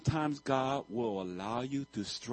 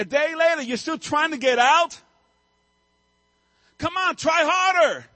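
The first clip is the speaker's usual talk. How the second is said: exasperated mock shout, much higher and louder; impatient yell; 14 semitones higher, 10 dB louder than usual. Viewer losing patience with the chick, mock exasperated yell. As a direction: hurry that lazy chick up.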